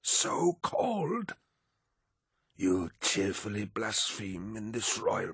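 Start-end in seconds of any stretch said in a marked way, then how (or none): 0.0-1.3 slow, much higher breathless whisper; whispered disbelief. A narrator's reading. Old man repeating Loken's insult, whispered outrage. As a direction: hiss it in scandalized shock. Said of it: "the man echoed".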